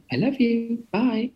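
high-pitched imitation of a gushing caller; high sing-out goodbye, imitating a friend; Lady Bunny. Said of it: "she calls me up sometimes".

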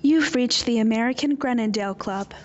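loud, formal phone recording; recorded greeting voice. Acted. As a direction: read it like a voicemail line.

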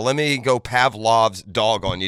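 ordinary talk

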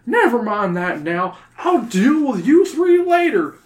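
high, loud teacher voice; stern teacher. Acted. The teacher lectures the kids about their missed trip, loud and high. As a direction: lecture them like a cross schoolteacher.

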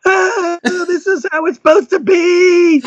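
overwhelmed with joy at the discovery; ecstatic. Retold or imitated. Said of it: "running around the house going".

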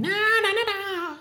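very high, sung whine imitating a tiny violin; mock violin. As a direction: squeal out a mournful little fiddle. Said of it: "just imagine the little violin".

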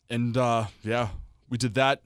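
ordinary delivery

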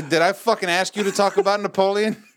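high, exaggerated questioning; mock indignation. Mock quiz answer as question.

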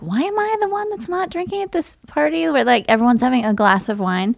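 high, whiny self-questioning; mock-whiny high voice asking why me; whiny inner voice. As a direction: complain to yourself like a left-out kid. Self-pitying thought at party.

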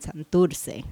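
usual speaking voice

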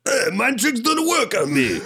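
hammed-up Watto from Star Wars; Watto impression.

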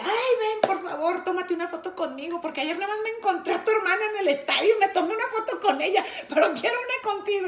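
high, gushing, breakneck plea; imitated excited fan. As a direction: play a thrilled fan begging a favor. Excited fan begging for photo.

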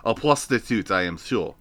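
put-on low, soft French accent; softly put-on French accent; mock French accent. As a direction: try the line with a French accent. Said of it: "I can't do a French accent".

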